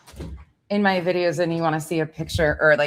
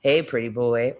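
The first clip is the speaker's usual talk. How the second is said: normal talking voice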